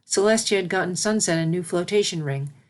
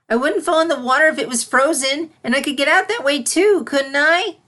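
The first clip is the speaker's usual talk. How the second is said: put-on high, louder pleading child; whiny filly; 9 semitones higher, 6 dB louder than usual. Filly arguing with her mentor, high and whiny. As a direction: bargain like a stubborn little filly. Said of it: "whined the filly".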